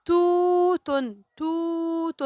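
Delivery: "توت" with a high, sustained و; long vowel. Shown as a demonstration. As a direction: stretch the oo in "توت" before the ending. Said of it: "نطقت التاء مع الواو".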